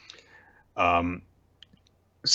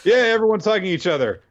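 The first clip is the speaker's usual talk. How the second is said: excited fast recap; 10 semitones higher, 4 dB louder, faster than usual. Real, not acted. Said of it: "excited recap".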